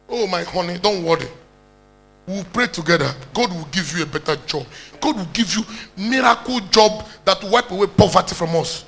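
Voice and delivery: tender reassurance. Acted. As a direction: hush him like a loving wife promising better days. Wife comforts crying husband.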